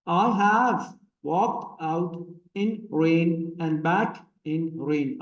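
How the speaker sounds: "I HAVE walked OUT in RAIN and BACK in RAIN", very slow beats; iambic scansion. Shown as a demonstration. Teacher demonstrates the metre to students, "walked OUT in RAIN" stretched and heavily stressed. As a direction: draw out each stressed word in "I have walked out in rain", pausing between feet. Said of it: "हमारी pronunciation उस तरह से नहीं होती".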